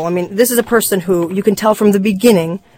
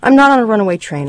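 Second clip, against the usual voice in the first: quick, higher-pitched protest; defensive denial; 4 semitones higher, 4 dB louder, faster than usual. Acted. Husband rebutting his wife's worry, defensive denial. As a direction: push back fast at the accusation.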